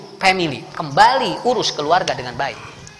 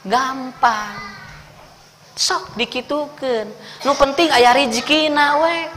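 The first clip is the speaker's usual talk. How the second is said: careless parent; 6 semitones higher, slower than usual. Pretend. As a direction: shrug it off like a lazy parent.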